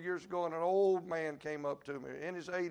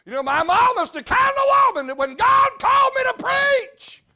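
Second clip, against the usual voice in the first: huge high shouted preaching; 21 dB louder than usual. Real, not acted. Preacher roaring to the congregation, full shout. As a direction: blast it out from the pulpit.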